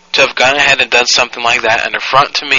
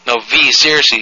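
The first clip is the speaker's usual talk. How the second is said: usual speaking voice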